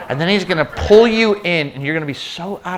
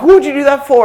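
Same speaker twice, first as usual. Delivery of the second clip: put-on higher, louder kid's outraged yelp; indignant son; 9 semitones higher, 10 dB louder than usual. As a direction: protest like a soaked boy. Son pushed into water.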